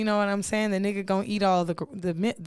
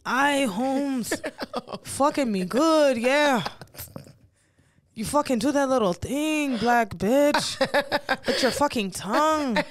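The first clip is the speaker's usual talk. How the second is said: broad, put-on Mexican-accented English; amused Mexican accent impression; mock Mexican accent; 2 semitones higher than usual. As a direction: play a jokey Mexican lover. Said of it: "A Mexican".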